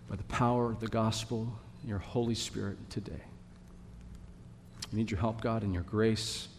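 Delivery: soft, low prayer voice. Real, not acted. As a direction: pray humbly to God, quietly. Pastor prays before the sermon.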